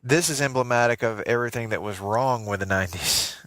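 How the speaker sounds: ordinary delivery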